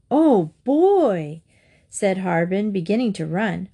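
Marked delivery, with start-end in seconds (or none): 0.1-1.4 put-on delighted boy. A narrator's reading. Boy thrilled about cake.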